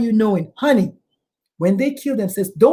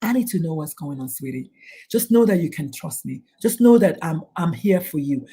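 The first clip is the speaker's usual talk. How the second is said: put-on a parent gently reassuring a struggling teen; gentle parent; 6 semitones lower than usual. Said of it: "go back in and say".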